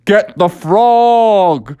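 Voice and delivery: slow, stretched-out call to action; drawn-out mock crowd shout; rallying cry. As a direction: holler it out, dragging each word. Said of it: "everybody was like".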